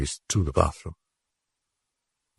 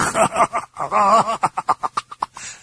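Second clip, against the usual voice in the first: shrill mocking cackle; high demon laugh. Demon mocks Karras, high cackle.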